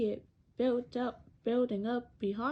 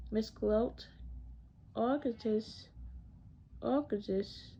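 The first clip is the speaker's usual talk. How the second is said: plain reading voice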